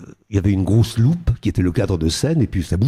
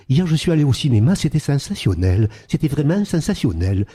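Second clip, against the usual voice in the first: much higher, sing-song southern Marseille accent; 8 semitones higher than usual. Shown as a demonstration. Showing the interviewer a southern Marseille accent, bright and sung. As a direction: lilt it like a sunny southern Marseille local. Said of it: "c'est pas du tout le même accent".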